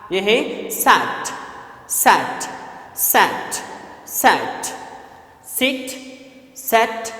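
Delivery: soft, slow drill of "सैट", "सिट" and "सेट"; vowel demo. Shown as a demonstration. Teacher drilling "सैट" against "सिट" and "सेट". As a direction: repeat each word clearly, as a drill. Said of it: "short vowel sound है वो है".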